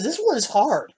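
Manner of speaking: high, lightly voiced little girl concentrating; little girl Sunny. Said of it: "She goes".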